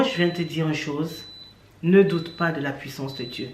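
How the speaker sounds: ordinary delivery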